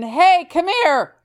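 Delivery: loud, drawn-out summons; mock calling out. Co-host playing a citizen making an arrest, loud calling out.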